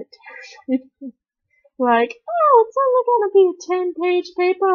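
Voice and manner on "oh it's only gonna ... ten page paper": mock teacher voice. Teacher quoted, high mock voice.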